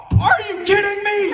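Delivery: very high, loud imitated shout; outraged sports-fan yell. Dad erupting at the Jets game mid-dinner, outraged yell.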